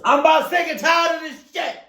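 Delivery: high, loud exasperated yell; fed-up shout. Acted. Sick and tired of a nagging wife, angry shouting. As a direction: blow up as the fed-up husband.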